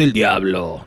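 low, slow devilish growl, playing possessed. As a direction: let the devil speak through you, low and dragged out. Possessed by the devil when angry.

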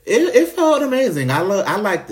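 thrilled. Real, not acted. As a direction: relive the rush of the kiss. Recalling the thrilling kiss.

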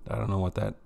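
usual speaking voice

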